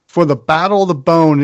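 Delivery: ordinary talk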